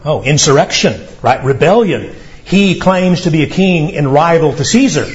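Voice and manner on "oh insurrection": usual speaking voice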